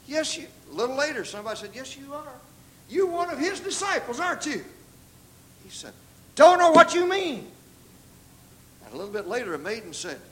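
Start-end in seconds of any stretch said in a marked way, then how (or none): 0.1-0.5 retold accusation. Retold accuser confronting Peter. 1.7-4.6 high-pitched, insistent challenge; acting out an accuser pressing hard; retold accusation. Retold accuser confronting Peter. 6.3-7.5 high, forceful protest; acting out an emphatic denial; retold loud denial. Peter's retold denial under accusation.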